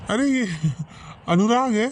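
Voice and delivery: high, loud, nervous reply; flustered answer. Acted. Names friend to cover the lie. (हड़बड़ाकर ऊँची आवाज़ में)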